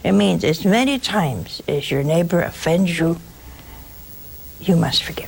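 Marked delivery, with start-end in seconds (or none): 4.6-5.3 whispered. Real, not acted. Solemn moral point.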